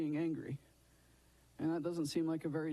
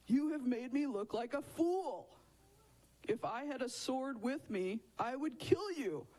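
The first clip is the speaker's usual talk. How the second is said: high-pitched, hammed-up outrage; mock mad shout; 6 semitones higher than usual. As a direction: play a furious man telling off his donkey. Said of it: "Balaam is so mad, he shouts back".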